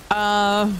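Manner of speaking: fighting off a sneeze; holding back a sneeze. Real, not acted. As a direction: talk through a held-back sneeze. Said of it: "I'm trying not to sneeze".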